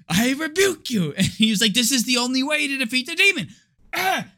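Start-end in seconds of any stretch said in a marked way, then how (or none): 0.1-1.1 higher-pitched, lightly hammed-up exorcist; mock rebuke. Exorcist throwing salt at the demon, mock rebuke. 1.7-4.3 high, rushed mock proclamation; hammed-up exorcist ending in a cry; mock exorcist zeal. Exorcist justifies throwing salt, mock zealous cry.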